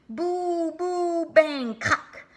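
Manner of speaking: high, loud ghost boos and bangs; spooky noises. Acted. Sammy acts out the spooky boos and bangs. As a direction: boom out the noises.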